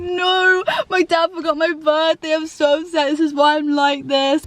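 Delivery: high, slow self-pitying wail; hammed-up drunk sob story; mock drunken whining. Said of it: "And I'm like".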